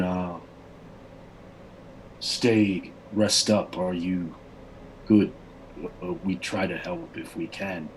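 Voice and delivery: proud warrior speaking carefully in a foreign tongue; barbarian leader. Acted. Barbarian leader reassuring the party, halting slow speech.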